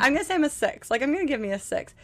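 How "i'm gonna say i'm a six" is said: fast, a bit higher rerun of his line; a lighter hint of the husband's voice; quoting husband again. Wife repeats husband's joke, lightly voiced and fast. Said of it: "it's funny when he says it".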